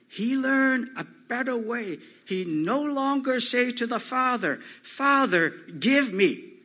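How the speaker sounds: loud, high-pitched pulpit proclamation; forceful preaching. Acted. Preacher showing how the son learned better, forceful preaching.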